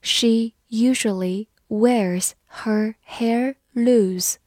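careful spaced-out model sentence; slow reading. Shown as a demonstration. Teacher reading the hair example for learners, slow reading. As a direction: read slowly, word by word. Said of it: "慢慢来读".

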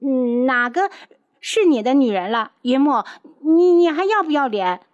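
high, loud, half-crying scold; flustered anger. Acted. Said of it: "气恼的道".

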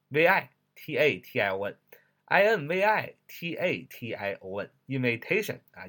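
ordinary delivery

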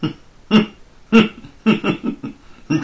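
loud, cold villain's snicker; cold sneering laugh. A narrator's reading. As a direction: sneer it out menacingly. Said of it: "罗庞冷笑一声". (恶狠狠的冷笑)

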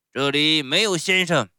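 loud, curt rebuff; icy refusal. A narrator's reading. Showman rebuffs Pinocchio's plea, cold and loud. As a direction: cut the plea off coldly at full volume. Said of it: "冷冰冰地回答说".

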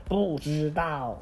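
slow, sulky "don't know"; sulky child. Acted. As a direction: drag it out sullenly.

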